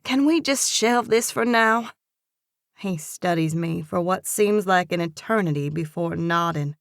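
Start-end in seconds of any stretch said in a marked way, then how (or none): 0.0-1.9 through clenched teeth; words pushed out through gritted teeth. A narrator's reading. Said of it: "my words come out through gritted teeth".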